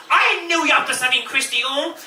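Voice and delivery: high, fast accusing cry; mock accusation. Christy accusing her baker friend, high and fast. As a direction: catch her out. Girl accuses after spitting out.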